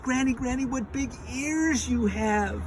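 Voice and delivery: put-on high, loud little-girl cry. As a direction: call it out high to Granny. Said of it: "then she says".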